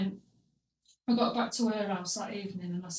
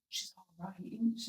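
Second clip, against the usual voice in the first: imitated whisper; 4 semitones lower, 11 dB quieter than usual. Grandma hinting about the girl.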